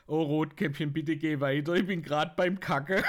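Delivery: Wolf lachend gespielt. Retold or imitated. Wolf antwortet, Pointe.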